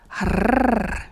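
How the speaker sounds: loud, sustained tongue trill; playful rolling "rrrr" noise; rolled R trill. Shown as a demonstration. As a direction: let a long R roll out. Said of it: "That is fun".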